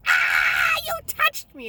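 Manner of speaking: imitated ferret shriek, loud and leaping high; mock high-pitched ferret scream; freaking-out ferret. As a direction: voice an outraged pet squealing at being touched. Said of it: "And she'd be like".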